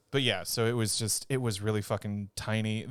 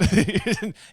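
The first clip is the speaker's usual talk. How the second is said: burst of laughter; 11 semitones higher, 8 dB louder than usual. Real, not acted. Laughing at the pun.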